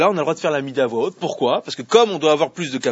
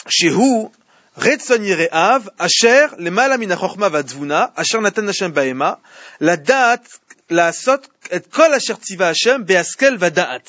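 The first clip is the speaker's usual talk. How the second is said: louder, measured recitation of the Hebrew; Hebrew text recited; 2 semitones higher, 3 dB louder, slower than usual. Acted. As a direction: read the passage out like a quoted source. Cite un passage hébreu. (récitation appuyée du passage en hébreu)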